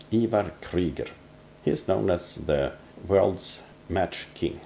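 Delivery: normal talking voice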